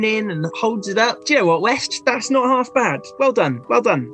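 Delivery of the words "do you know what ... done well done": higher, pleased professor; lightly voiced approving teacher. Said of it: "He dips a little spoon".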